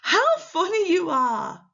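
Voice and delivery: high, loud, delighted exclamation. Shown as a demonstration. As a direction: exclaim it with big amused surprise. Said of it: "with the exclamation mark".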